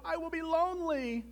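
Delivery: high, plaintive wail; shouted plea. A narrator's reading. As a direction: wail it out to a lost friend. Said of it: "he shouted".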